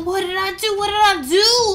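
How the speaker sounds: high panicked cry; panicked shout. Acted. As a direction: yell in a panic. Hair on fire panic.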